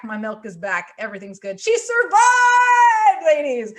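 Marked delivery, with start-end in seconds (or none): 1.6-3.7 high, loud, slow drawn-out victory cry; triumphant cheer. Acted. Announcing to the ladies that the baby survived, in a slow triumphant cry. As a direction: belt it out like a big win.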